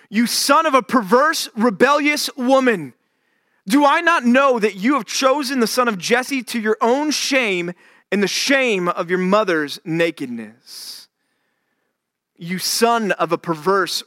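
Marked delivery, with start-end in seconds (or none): 0.1-2.9 enraged insult. Acted. Saul's enraged outburst, first take. 3.7-11.1 much higher and louder, slowing into rage; furious king. Acted. Saul's enraged outburst at Jonathan.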